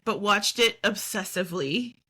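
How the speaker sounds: ordinary talk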